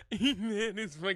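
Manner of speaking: cracking up mid-sentence; laughing so hard it breaks. Real, not acted. Repeat while laughing.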